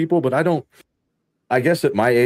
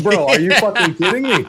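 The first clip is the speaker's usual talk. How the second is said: disbelieving retort; 8 semitones higher, 4 dB louder than usual. Retold or imitated. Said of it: "And I'm like".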